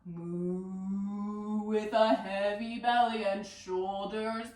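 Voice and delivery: low, drawn-out cow voice; cow moo. Pretend. Teacher plays Cow responding to Cat, deep slow moo. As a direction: sink into a deep, heavy moo and stay down there. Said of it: "Cow responds".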